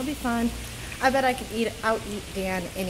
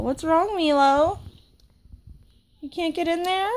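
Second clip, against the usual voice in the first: pet talk; 6 semitones higher than usual. Real, not acted. Talking to cat Milo.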